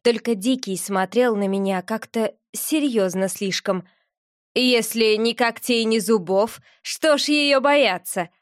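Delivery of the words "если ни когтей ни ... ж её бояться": usual speaking voice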